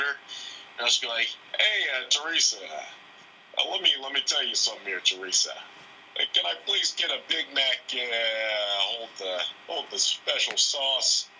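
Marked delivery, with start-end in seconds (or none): 1.6-11.3 Patrick Warburton voice. Retold or imitated. Ordering at McDonald's in a Patrick Warburton voice. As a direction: lay on the Warburton deadpan at the drive-thru. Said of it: "I like to do, like, Patrick Warburton".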